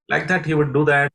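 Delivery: normal talking voice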